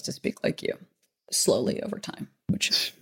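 unvoiced whisper; whispered aside. Real, not acted. Teasing aside, dropped to whisper.

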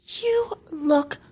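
high, slow, gushing mom; doting mom voice. Retold or imitated. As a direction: gush over it like a proud mother. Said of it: "My mom would be like".

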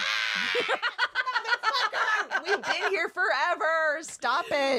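high, laughing mock rant; exasperated outburst. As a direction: blurt it out high through laughter. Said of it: "I'm like".

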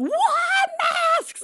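husband shocked that masks are the answer; surprised. Retold or imitated. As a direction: jump up in pitch at "What?". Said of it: "Stefan ... was like".

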